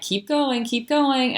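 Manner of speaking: voices urging her on. Retold or imitated.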